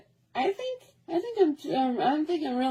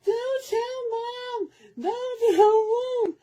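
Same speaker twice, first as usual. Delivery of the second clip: put-on high, slow begging kid voice, drawn out; pleading little brother; 14 semitones higher, much slower than usual. Bleeding brother begging his sister not to tell, high and slow.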